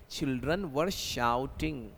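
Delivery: very slow translation; slow dictation. Shown as a demonstration. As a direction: lay out each word. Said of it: "बच्चे हैं ना चिल्ला रहे थे". (धीरे अनुवाद)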